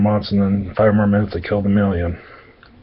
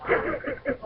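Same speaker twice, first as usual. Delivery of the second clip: laugh; 13 dB quieter than usual. Real, not acted. Laughs at own joke.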